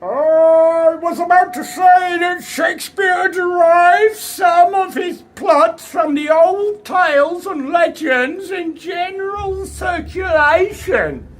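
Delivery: high, loud, slow oratory; hammed-up orator railing from a podium; ranting Dickens. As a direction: declaim like a furious Victorian lecturer. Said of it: "Chas was pretty worked up".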